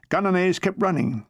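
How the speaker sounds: ordinary delivery, nothing marked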